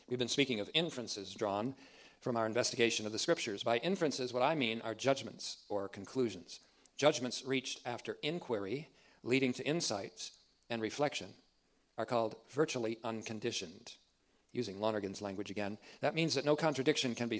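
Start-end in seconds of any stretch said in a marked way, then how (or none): none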